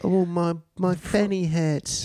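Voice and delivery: high, slowing British whine; mock British accent. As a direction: complain in a high put-on British voice. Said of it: "You know they call f-, pussy fanny there?".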